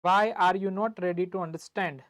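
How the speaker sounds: very slow, spaced-out teacher repeat; slowly, so the class can write; slow dictation. Shown as a demonstration. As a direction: dictate it word by word.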